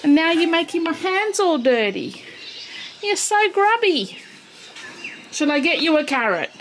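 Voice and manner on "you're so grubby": fond scolding. Real, not acted. Scolding the horse fondly.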